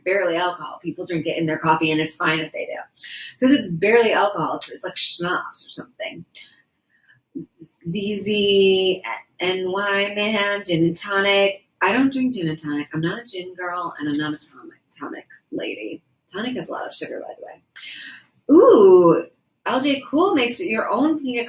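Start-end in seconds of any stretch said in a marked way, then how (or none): 4.6-6.2 breathy whispered aside; whispering. Real, not acted. Unvoiced aside. 17.2-17.6 tiny whispered aside; whispering. Real, not acted.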